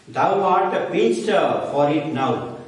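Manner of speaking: dramatic recitation. Shown as a demonstration. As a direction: intone it like a stage actor. Teacher declaims Shakespeare quote.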